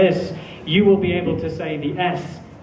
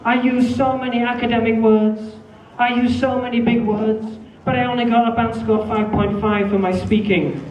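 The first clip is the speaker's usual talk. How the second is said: higher-pitched voice of a frustrated student; complaining student; 5 semitones higher than usual. Retold or imitated. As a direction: voice a disappointed student. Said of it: "I had a student come up to me".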